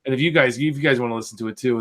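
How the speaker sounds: ordinary delivery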